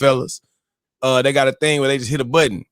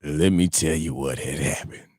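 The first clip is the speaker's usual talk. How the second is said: brother's deep voice; 12 semitones lower, 6 dB quieter than usual. Retold or imitated. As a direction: imitate the friend in a deep, quiet voice. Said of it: "I didn't like the voice you just used".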